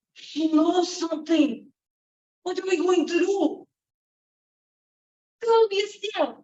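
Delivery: worried, scheming servant; nervous manservant. Acted. Manservants fretting to each other, lightly voiced.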